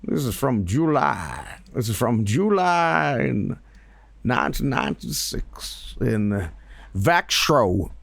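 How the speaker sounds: slow, folksy prospector; lightly voiced old prospector. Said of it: "old prospector guy".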